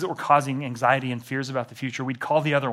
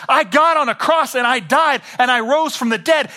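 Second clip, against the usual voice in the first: loud, higher, cutting; blunt command; 10 semitones higher, 7 dB louder than usual. Retold or imitated. God telling an anxious believer to stop, loud and blunt. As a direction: snap it like a stern father. God voiced yelling at stubborn person.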